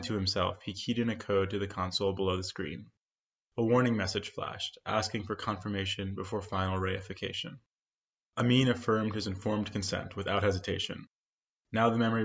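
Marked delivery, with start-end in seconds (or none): none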